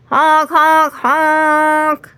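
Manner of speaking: high, loud horn imitation; honking horn. A narrator's reading. The car horn blares at Henry, loud honk.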